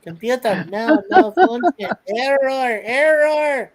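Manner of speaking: stuttering computer voice, rising; glitching robot. Acted. Joins the computer gag.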